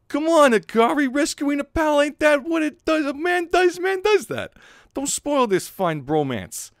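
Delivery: high, loud put-on punk voice; pal urging a rescue. Punk pal urging a friend to help, high and insistent.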